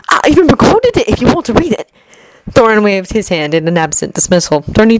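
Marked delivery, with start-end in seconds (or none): 0.1-1.9 higher and fast, keen to share; bursting to show his book; eager rush. Acted. Ori eagerly offers Thorin his record, fast and higher. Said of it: "Ori nodded eagerly".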